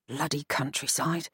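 low muttered curse. A narrator's reading. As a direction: mutter it under your breath. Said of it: "he muttered".

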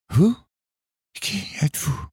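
high-pitched voice in broken words; wounded girl forcing out words, very high; high, halting girl's voice; halting young woman. A narrator's reading. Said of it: "dit-elle à mots hachés".

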